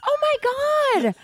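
high, drawn-out exclamation of disbelief; slow, high-pitched oh-my-God, playfully appalled; mock outrage. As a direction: stretch it out high in mock indignation. Mock outrage at being blamed.

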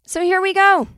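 high, loud kickoff; excited announcement. Real, not acted. Announcing the segment.

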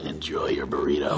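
very low angel character voice; Castiel. Shown as a demonstration. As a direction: drop the pitch way down, restrained delivery. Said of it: "Now, now do the Castiel".

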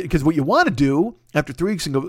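ordinary delivery

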